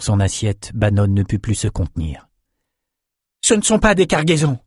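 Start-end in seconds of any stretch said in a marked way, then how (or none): 3.4-4.6 growled. A narrator's reading. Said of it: "grogna-t-il".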